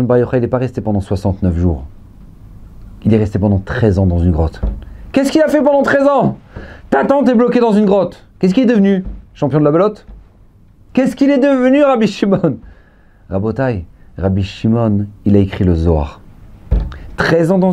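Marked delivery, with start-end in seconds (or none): 5.1-12.6 high, urgent rhetorical questions; raised-pitch sermon challenge; impassioned preaching. Real, not acted. Preaching at full force.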